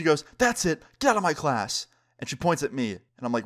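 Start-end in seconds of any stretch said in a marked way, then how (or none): 0.4-1.9 high-pitched mock outburst; exasperated order in a raised pitch; fed-up teacher. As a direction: imitate a teacher throwing a student out. Angry teacher throws student out.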